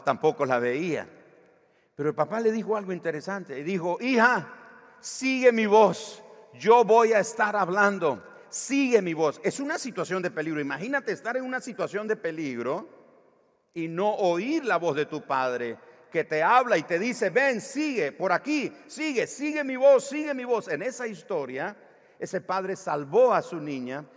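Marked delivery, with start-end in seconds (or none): 4.0-9.4 louder, higher calling to his daughter. Retold or imitated. Father guiding his daughter through smoke, loud call. 17.3-20.7 louder, higher father's call. Retold or imitated. Father urging child to follow his voice, calling out.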